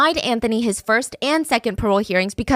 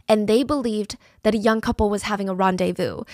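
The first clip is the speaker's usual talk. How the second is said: ordinary talk, nothing marked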